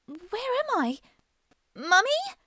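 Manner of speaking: put-on high child's voice calling out for mummy; small girl lost in the dark, calling; little girl calling. A narrator's reading. Lost little girl calls for her mother in a dark room, high and unsure. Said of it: "she called".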